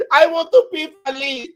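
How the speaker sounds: a Dominican accent played for laughs. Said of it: "I start talking like that".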